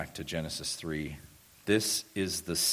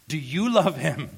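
loud, high preacher's challenge; fervent pulpit shout; impassioned question; 8 semitones higher, 8 dB louder than usual. Real, not acted. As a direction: cry the question out urgently to the congregation. Same question repeated in full cry.

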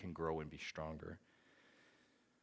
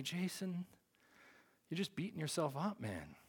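put-on older brother consoling; 10 semitones higher than usual. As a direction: imitate a brother gently letting him off. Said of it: "and he said to me".

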